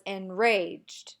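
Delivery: en-RAGED, slow with a strong second syllable; enraged with a light EN; emphasis on RAGE. Shown as a demonstration. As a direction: lean hard on RAGED in enraged. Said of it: "the rage gets more emphasis than the N".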